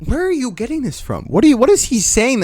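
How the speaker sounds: high, exasperated questioning; incredulous. Real, not acted. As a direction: demand answers in disbelief. Arguing with his defender.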